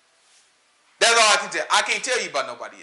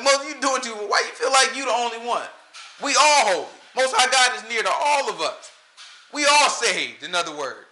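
mock rebels; 7 semitones higher than usual.

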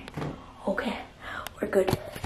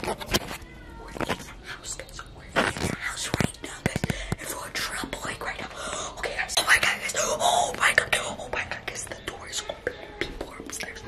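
very soft, secretive whisper; hushed whisper; 10 dB quieter than usual. Acted. Intruder whispering to viewers as people move upstairs, hushed whisper. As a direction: whisper low so the people upstairs can't hear.

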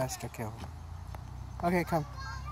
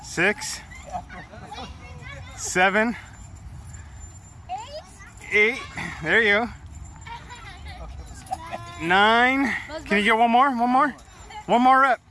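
gym spotter encouragement; slower than usual. Real, not acted. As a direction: push him through the last rep. Urging a lifter through reps.

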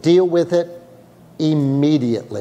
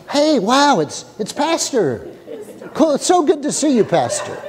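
mock casual delight; 8 semitones higher than usual. Pastor mocks casual disciple greeting.

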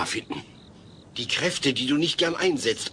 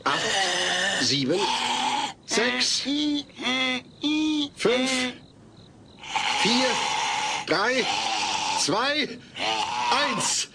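tense countdown; 6 semitones higher, much slower than usual. Acted. Countdown to fake power display.

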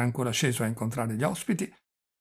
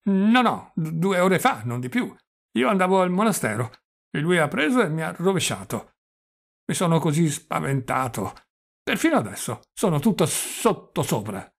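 put-on raised, loud old man with a stutter; doddering old prince, flustered; 4 semitones higher, 5 dB louder than usual. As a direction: insist agitatedly, stumbling over words. (vecchio principe agitato e balbettante)

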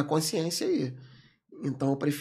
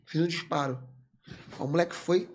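ordinary talk, nothing marked

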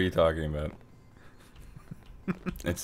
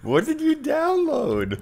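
laughing; 13 semitones higher than usual. Real, not acted. Laughing at broken map.

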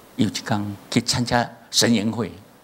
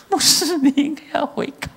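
much higher, louder scolding; mock rebuke; 4 dB louder than usual. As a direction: rebuke the pastor in a high, loud voice. Pastor rebuked, retold.

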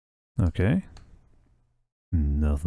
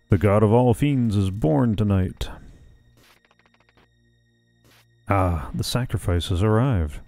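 ominous cult leader. Acted. Voicing fiend cultist leader.

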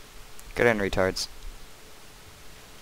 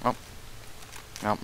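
ordinary talk

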